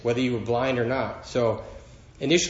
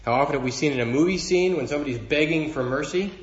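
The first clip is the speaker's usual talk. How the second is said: ordinary delivery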